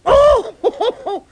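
very high, loud delighted laughter; laughing with joy. A narrator's reading. Boy finding his spider friend alive, laughing. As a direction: laugh out your relief.

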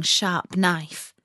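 usual speaking voice

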